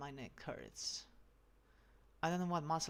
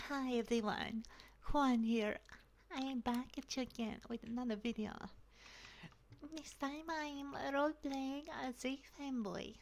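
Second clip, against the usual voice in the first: sing-song high fanboy intro, much higher than his own; fanboy voice demo; 8 semitones higher than usual. Shown as a demonstration. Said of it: "I've been doing this voice too long".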